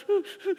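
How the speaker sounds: high, mimicked sobs; mock sobbing. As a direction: sob in self-pity, hammed-up. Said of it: "crying, self-pity".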